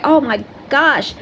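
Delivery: bright, high, bubbly goodbye; cheery greeting. Retold or imitated. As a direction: gush happily like seeing an old friend.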